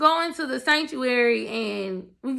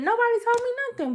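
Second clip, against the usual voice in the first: excited; 11 semitones higher, faster than usual. Retold or imitated. Retelling reaction to news.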